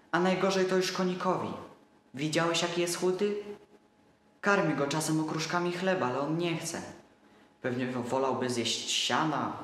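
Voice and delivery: put-on little boy voice. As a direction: voice him like a trusting small boy. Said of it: "szeptał dalej mały".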